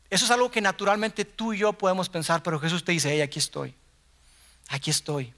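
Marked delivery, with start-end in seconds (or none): 3.1-5.3 soft, low, tender call; hushed, reassuring "here I am"; gentle reassurance. Retold or imitated. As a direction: murmur it like a gentle invitation. Jesus voiced gently, contrasted.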